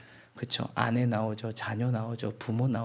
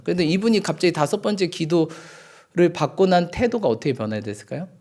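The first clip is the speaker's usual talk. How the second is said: usual speaking voice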